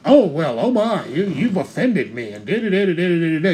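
lightly mock the easily offended; mock offended whiner. To the audience, mocking the people who claim offense, a lightly high mock whine. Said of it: "wanna sit back".

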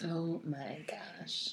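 slow breathy whisper. Real, not acted. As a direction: whisper it in awe. Whispered reaction to the ending.

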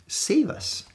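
unvoiced pleading "Save us."; whispered plea. Shown as a demonstration. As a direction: whisper "Save us." high and soft.

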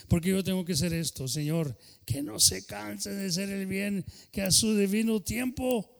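plegaria en voz alta y sentida, subiendo con fervor; oración ferviente. Acted. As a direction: ora con fuerza por los hermanos. Fervent prayer for the congregation. (pastor orando con fervor ante la congregación)

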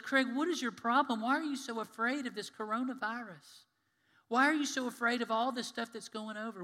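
loud, high scolding questions; Jesus questioning Craig's fear, loud and exasperated; exasperated challenge. Retold or imitated. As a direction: shake him out of his fear.

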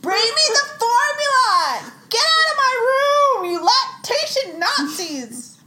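furious shout, high and loud; screaming. Pretend. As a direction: scream them out of the room. Mom screaming at lactation staff.

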